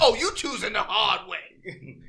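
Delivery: loud, slow, high mock threat, laughing through it; a laughing send-up of a villain's taunt; hammed-up menacing character. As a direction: ham up a cartoon predator's threat, drawn out and loud. End of the South Park quote.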